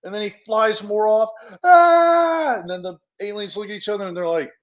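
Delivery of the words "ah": high, loud aah; flung-away cartoon scream; acted scream. Hero flying off from the spaceship, acted scream.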